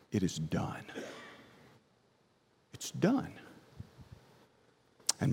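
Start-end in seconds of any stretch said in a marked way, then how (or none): 0.1-3.3 very low, soft, hushed; inner divine voice. Retold or imitated. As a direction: let it settle like a quiet certainty. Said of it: "I had this unusual sense".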